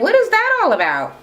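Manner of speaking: high, prying mock question; high put-on curiosity; high nosy Jackie. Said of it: "And Jackie like".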